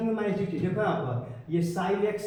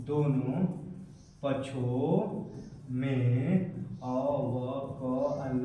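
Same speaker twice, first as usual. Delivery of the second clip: drawn out syllable by syllable, lower; slow dictation; 4 semitones lower, much slower than usual. Real, not acted. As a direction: dictate slowly as you write. Dictating slowly while writing it out.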